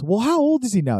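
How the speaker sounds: lightly voiced Santa probing the elf; curious Santa. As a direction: ask it in a lifted put-on voice. Santa asking.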